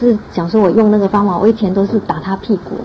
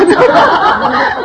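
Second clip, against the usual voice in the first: very high, loud laugh; high burst of laughter; 4 dB louder than usual. Real, not acted. Mother bursts out laughing mid-story to the group, very high. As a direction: break into laughter.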